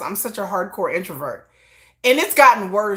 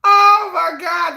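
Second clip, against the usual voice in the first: excited outburst; 6 semitones higher, 4 dB louder, much slower than usual. Real, not acted. Excited outburst recalling scene.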